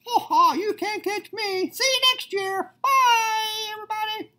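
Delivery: very high, laughing leprechaun taunt. Acted. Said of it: "the leprechaun stepped out of the way".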